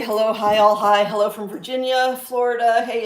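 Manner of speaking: normal talking voice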